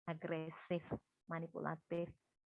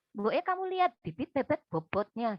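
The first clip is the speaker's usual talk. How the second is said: nagging Javanese mother; 4 semitones higher, 6 dB louder than usual. Retold or imitated. Parent voiced in a film quote.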